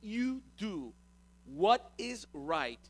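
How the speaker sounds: very slow, deliberate challenge; God confronting, measured and weighty. Acted. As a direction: spell it out word by word. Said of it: "And then he confronts him".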